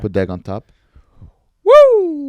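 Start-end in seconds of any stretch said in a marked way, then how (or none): 1.6-2.3 high, loud whoop; cheering. Real, not acted. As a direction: whoop with delight. Excited about food.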